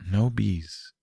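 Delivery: soft, soothing murmur. A narrator's reading. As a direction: hush it gently to calm him. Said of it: "Derpy murmured soothingly".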